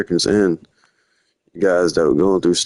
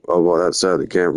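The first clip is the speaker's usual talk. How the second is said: ordinary talk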